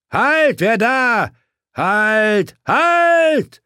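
high, loud shouted halt; challenging shout. A narrator's reading. Lone knight shouting at riders in the snow to halt. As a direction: stop the riders cold.

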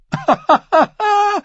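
high, mocking laugh; loud laugh. A narrator's reading. Ascher laughs at Euron's claim, high loud laugh. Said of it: "Ascher lachte laut auf".

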